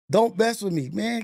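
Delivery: usual speaking voice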